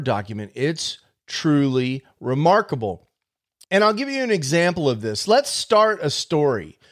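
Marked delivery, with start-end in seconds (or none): none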